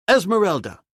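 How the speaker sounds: loud urgent call. A narrator's reading. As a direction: call her name sharply. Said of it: "he cried again".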